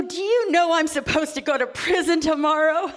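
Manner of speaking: hammed-up astonished question, pitched well up; retold incredulous outburst. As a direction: blurt it out in comic disbelief. Retold outburst to the boy's mother.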